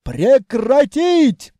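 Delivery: high, loud, drawn-out order; shouted command. Acted. Grandmother blocks the passage with her hand, shouted command. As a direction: bellow at them to stop.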